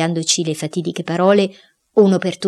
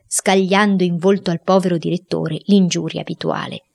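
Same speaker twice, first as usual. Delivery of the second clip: ordinary delivery, nothing marked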